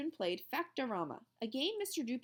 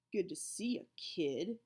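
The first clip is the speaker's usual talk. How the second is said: growl. A narrator's reading. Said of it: "growled a deep voice".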